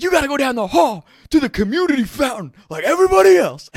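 high, full-volume hollered order; loud, high mock scolding; outraged roommate yell. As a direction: imitate an indignant roommate shouting. Roommate yells at the girl.

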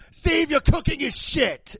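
high, loud, drawn-out British abuse; hammed-up chef's tirade; Gordon yelling. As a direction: tear into the cook at full volume. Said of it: "British guy comes in and just yells at you".

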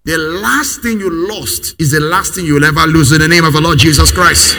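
loud, ringing declaration rising to the name of Christ; full-force preaching. Acted. Pastor proclaiming to the congregation, full-force preaching. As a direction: preach it like a decree over the crowd.

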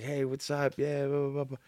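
excited greeting. Retold or imitated. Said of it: "He's like".

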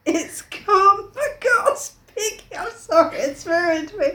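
high, slow, wailing dread; hammed-up terror. Piggy fearing the beast has come, high wail. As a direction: wail it slowly in mock fear. Reads Piggy terrified, voice put on.

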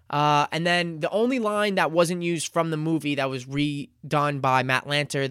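usual speaking voice

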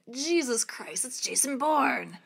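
high, slow mock-dramatic exclamation; meme quote. Friend doing their favourite movie meme, hammed-up. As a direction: shout the meme line in a put-on voice. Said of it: "our favorite meme".

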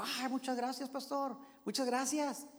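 a lightly voiced, pleased neighbour saying thanks; grateful neighbour. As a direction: play the neighbour gushing thanks for the tacos. Neighbour thanks pastor for tacos.

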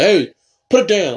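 a hint of a scolding dad; weak scary father. Shown as a demonstration. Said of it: "like, a scary voice".

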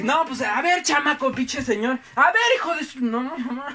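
put-on teen picking a fight with the kid's father, loud and angry; ready to fight. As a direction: square up and shout at the man. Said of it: "me puse así bien, bien al pedo".

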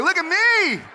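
showing off. Retold or imitated. Dad living his best life in Hawaii, showing off. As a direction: crow it like a proud dad.

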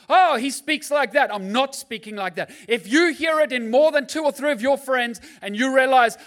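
loud, high, insistent sermon voice; preaching at full force. Acted. Preacher challenging the congregation, loud and insistent. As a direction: hammer the point home to the crowd.

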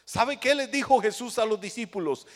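usual speaking voice

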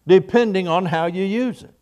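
usual speaking voice

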